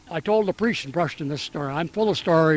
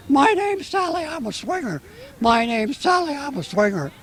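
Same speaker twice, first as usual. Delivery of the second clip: higher-pitched parrot imitation; 8 semitones higher than usual. Joke teller voicing the parrot for the interviewer, high parrot voice. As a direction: chirp it up high like a parrot on a swing.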